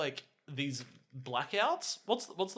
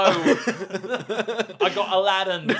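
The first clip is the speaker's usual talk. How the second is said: laughing; 4 semitones higher, 9 dB louder, much slower than usual. Retold or imitated. Guy Ritchie answering, laughing.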